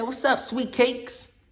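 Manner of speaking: put-on flirty voice, high and slow; imitating the lady. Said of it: "She said".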